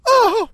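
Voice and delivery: loud, high pained yelp; big imitated agony cry; mock cry of pain. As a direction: yelp as if stabbed, hammed up. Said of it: "he's in so much pain".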